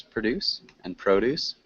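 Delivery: produce first as pruh-DUCE, then as PROH-duce; two pronunciations. Shown as a demonstration. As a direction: contrast the verb produce with the noun produce. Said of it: "can you repeat these two pronunciations".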